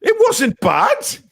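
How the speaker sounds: high, drawn-out indignant squeal; mock-outraged high-pitched denial; indignant protest. Protests at criticism of his accent.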